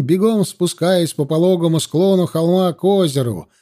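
plain reading voice

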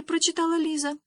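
plain reading voice

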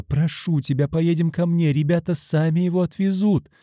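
high, urgent begging; imploring. A narrator's reading. Woman begs him to come home with her, imploring. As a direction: plead with him, keeping the pitch up. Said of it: "взмолилась Ольга".

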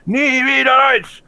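high, mocking whiny voice. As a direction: parody the slogan in a high silly voice. Mocking the slogan's speakers.

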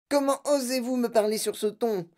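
high-pitched, mildly yelled outrage; indignation. A narrator's reading. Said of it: "hurla madame Salt". (voix aiguë et indignée)

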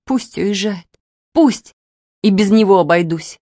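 wounded, uneven and weepy; through tears. A narrator's reading. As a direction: insist through held-back tears. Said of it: "проговорила девушка сквозь слёзы".

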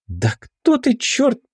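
изумлённо, с высоким подъёмом голоса; растяни вопрос, поражаясь собеседнику; изумление. A narrator's reading. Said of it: "изумилась Бритва". (ошарашенно, высоко и протяжно)

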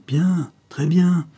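a villain savouring his revenge; sinister sneer. Acted. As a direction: drag it out with menace. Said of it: "avec un ricanement sinistre".

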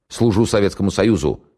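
cheerful barked salute; loud gung-ho soldier. Acted. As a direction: bark it out like a keen soldier. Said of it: "весело рявкнул капитан".

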